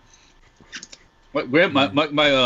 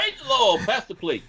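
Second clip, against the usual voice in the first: high-pitched preacher parody; mock pulpit preacher; 11 semitones higher than usual. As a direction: play a preacher working the congregation. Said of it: "back to the pulpit".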